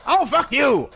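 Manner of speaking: loud, high furious yell; angry yell. Real, not acted. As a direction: yell at the attacker. Attacked by night guard.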